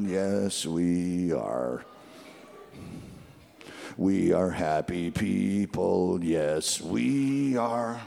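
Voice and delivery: slow, low, joyless drone; unhappy-sounding happy people. Acted. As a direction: drag the happy words out low and glum. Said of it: "You don't sound happy".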